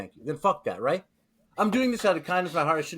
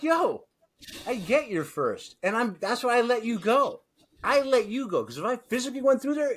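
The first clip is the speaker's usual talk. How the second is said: higher-pitched exasperated mock plea; mock protest; 6 semitones higher than usual. Driver arguing with a staring pedestrian, exasperated. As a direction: plead your case, pitched up.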